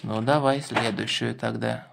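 usual speaking voice

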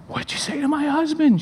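very high-pitched mock tearful voice; hysterical wife. Crying wife phoning pastor about her husband, mock hysterical. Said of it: "she's hysterical".

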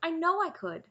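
high-pitched, insistent protest. A narrator's reading. Taryn insisting he could make a sword, protesting. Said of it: "Taryn protested".

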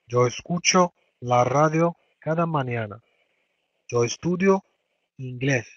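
slow, careful model sentence; slow dictation pace for students. Shown as a demonstration. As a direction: say each word slowly for learners. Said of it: "teacher models sentence slowly".

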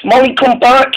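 high-pitched call after a friend; lightly voiced engine calling after a friend. Dennis calling after Molly.

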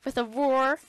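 usual speaking voice

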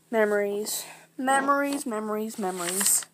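self-made echo, fading out; fading memory echo. Acted. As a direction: repeat it like an echo dying away. Echoed word fading into flashback.

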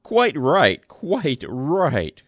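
loud hearty quite right; cried out agreeing. A narrator's reading. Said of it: "cried the boss".

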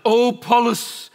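loud, slow anguished cry in Latin. Retold or imitated. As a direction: cry out in Latin like the shocked bishop. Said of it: "And he cries out".

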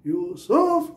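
Yusuf with सुफ jumping up high; short यू, then push सुफ up in Yusuf; stress on second syllable. Shown as a demonstration. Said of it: "तो stress दूसरे part पे चला गया".

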